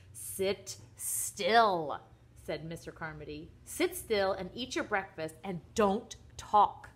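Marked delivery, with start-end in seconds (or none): 0.1-2.0 loud, two words set apart; stern father's order. A narrator's reading. Father stops son leaving his chair, stern and loud. 3.6-6.9 loud, slow, separated words; stern father's order. A narrator's reading. Father to fidgeting son at breakfast, stern slow command.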